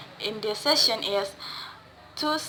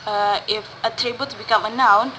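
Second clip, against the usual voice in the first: normal talking voice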